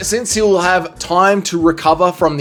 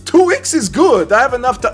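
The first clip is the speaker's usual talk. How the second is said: badly faked Brazilian accent, pitched higher; a clumsy first try at Moicano's Brazilian accent; horrible Moicano accent; 8 semitones higher than usual. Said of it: "that is a horrible Moicano accent".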